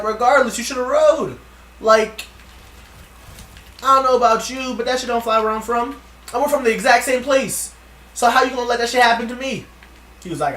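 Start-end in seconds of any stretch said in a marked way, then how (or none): 0.0-1.4 raised, higher-pitched indignant comeback; angry retort. Retold or imitated. Arguing with Leaf over not helping, angry retort. Retold heated argument with Leaf. 1.8-9.7 loud, high-pitched scolding; indignant rant. Retold or imitated. Confronting Leaf about letting it happen, indignant rant. Retold heated argument with Leaf.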